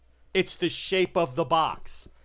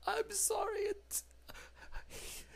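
high creature whimper; 11 dB quieter than usual. Acted. The creature fearfully whimpers about Arturo, very high and soft. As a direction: squeak out a frightened apology. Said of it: "GM voices creature".

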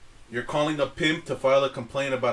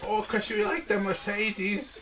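put-on Chinese restaurant accent; 5 semitones higher, 4 dB quieter, slower than usual. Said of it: "Why do you sound like the guy at the Chinese restaurant?".

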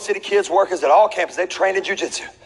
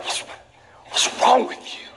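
high, slow breathy exasperation; mock exasperated parent; 5 semitones higher, slower than usual. Parent glaring at a kid who did something dumb, mock exasperation. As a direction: sigh it at the kid in disbelief. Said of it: "stop myself from looking at my kids and being like".

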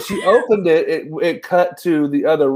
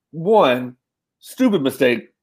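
usual speaking voice

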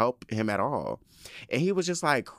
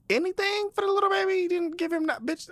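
very high, rushed outrage; pissed-off pleading. Real, not acted. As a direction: squeal it out fast in exasperation. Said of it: "I'm really pissed off about that".

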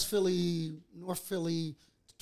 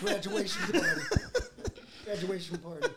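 laughing. Real, not acted. Joking, laughter around.

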